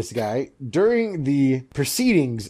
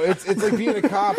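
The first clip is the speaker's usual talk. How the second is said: laughing; 6 semitones higher than usual. Real, not acted. Laughing while talking.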